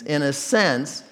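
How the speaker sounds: ordinary talk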